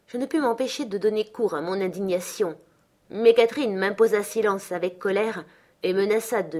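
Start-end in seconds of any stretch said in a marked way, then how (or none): none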